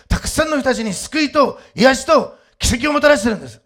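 high, driving sermon delivery; impassioned preaching. Real, not acted. Preacher urging congregation to take up the cross, impassioned preaching.

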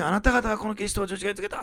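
high-pitched, imitated bold charge; blunt, straightforward accusation. Preacher retells Peter's accusation to the crowd, bluntly. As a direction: deliver it straight at the crowd, holding nothing back. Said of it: "すごいこうストレートに、".

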